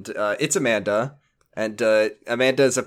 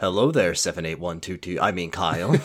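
low, quiet sinister robot; robotic villain; 7 semitones lower, 4 dB quieter than usual. Retold or imitated. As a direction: slip into a cold machine voice. Voicing villain Ballantyne.

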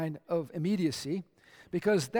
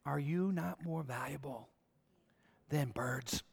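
hushed question; 4 semitones lower, 7 dB quieter, slower than usual. Acted. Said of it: "he drops the bomb on them".

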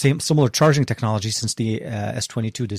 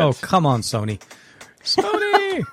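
exasperated pleading; 7 semitones higher, slower than usual. Real, not acted. Phone app won't connect to Sony.

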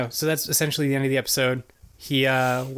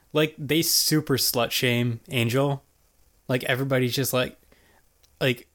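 ordinary talk

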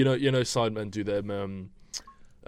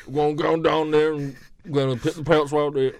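put-on mumbled Southern accent; 6 semitones higher, 7 dB louder than usual. Mocking Tom Hardy's film role to podcast friends, incomprehensible Southern accent. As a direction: imitate an unintelligible thick Southern accent. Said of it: "I couldn't understand a fucking word".

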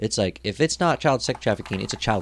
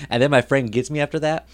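normal talking voice

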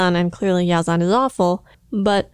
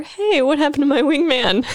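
lightly voiced, higher, offhand quip; mock careless commander; 6 semitones higher than usual. Co-host mocking Yazan's indifference, in a higher offhand voice. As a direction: toss it off like a commander who barely cares.

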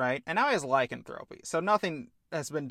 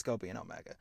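low dismissal; 7 semitones lower, 8 dB quieter, much faster than usual. Acted. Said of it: "Pushes him away and says".

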